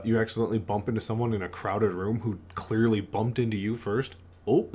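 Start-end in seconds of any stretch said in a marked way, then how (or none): 4.4-4.7 a quick startled Oh said as ope; Midwest ope. Shown as a demonstration. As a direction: blurt Oh like a Midwesterner bumping someone. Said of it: "the ope".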